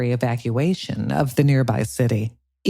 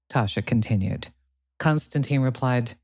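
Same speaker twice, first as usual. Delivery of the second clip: ordinary delivery, nothing marked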